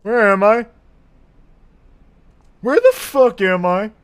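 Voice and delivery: high, loud, slow frightened question; high loud panicked question; panicked confusion. Real, not acted. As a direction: shout it lost and scared. Panicked lost in game.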